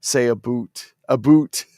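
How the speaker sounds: teasing imitation of Canadian "about"; mock Canadian accent. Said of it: "he'd say".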